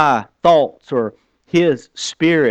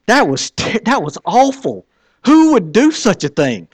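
much higher, louder disbelief; exasperated outcry; 7 semitones higher, 3 dB louder than usual. Retold or imitated. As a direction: throw up your hands at it. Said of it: "retold frustration, pitched up".

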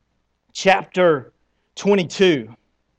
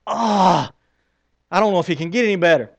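ordinary delivery

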